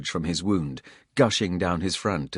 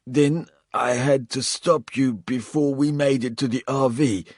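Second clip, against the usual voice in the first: higher, strained voice of a bleeding traitor; wounded Coles; 7 semitones higher, 4 dB louder than usual. A narrator's reading. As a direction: spit it out pained and defiant. Narrator voices confessing Coles.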